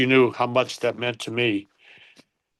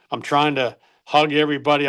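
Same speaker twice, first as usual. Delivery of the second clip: ordinary talk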